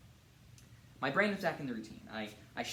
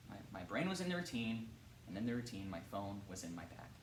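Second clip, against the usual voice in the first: low, subdued, sinking softer to the end; 3 semitones lower, 5 dB quieter than usual. Acted. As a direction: voice drops away after an outburst. Narrator repeats it, subdued, after outburst.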